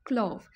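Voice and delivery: क्लोव with the vowel of क्लॉक; like clock. Shown as a demonstration. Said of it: "उस तरह से आप बोलेंगे क्लोव".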